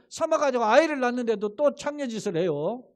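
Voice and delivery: usual speaking voice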